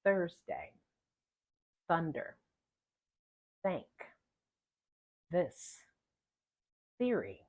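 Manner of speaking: Thursday, thunder, thank with slow careful th; exaggerated th practice. Shown as a demonstration. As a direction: show each th with tongue out. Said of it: "See how I produce the word".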